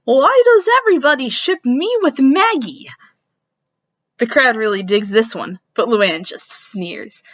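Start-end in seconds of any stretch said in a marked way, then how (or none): 0.0-2.9 loud, high whiny question; loud indignant whine. Acted. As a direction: complain it to the crowd. Character complains onstage.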